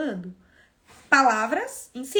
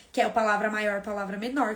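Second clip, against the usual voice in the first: ordinary talk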